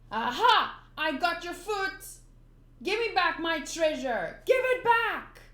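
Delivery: loud, high, rising demand; angry shout. Acted. Nami yelling at Buggy, loud and high. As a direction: yell at him for the treasure.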